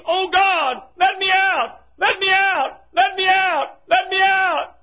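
high, repeated holler of desperation; screaming plea. Acted. Man burning in hell pleading with God, high scream. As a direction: scream to be released, each cry alike. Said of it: "as he screams".

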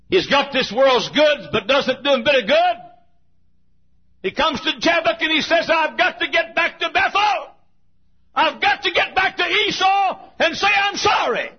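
high, loud, crying out to go back; desperate shouting. Acted. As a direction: shout his need to make it right. Jacob desperate at Jabbok.

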